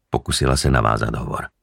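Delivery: ordinary delivery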